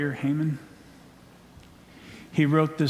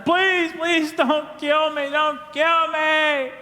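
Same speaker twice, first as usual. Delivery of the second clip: high, wailing mock begging; hammed-up high whimpering plea; 13 semitones higher, slower than usual. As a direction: plead like a desperate coward, pitched way up. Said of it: "pleading with her, begging".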